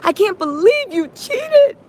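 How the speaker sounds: high, drawn-out mock outrage; retold berating. Host acts out him berating her over cheating, high indignant rant. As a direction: wail the accusation in exaggerated indignation. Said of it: "He literally started berating her".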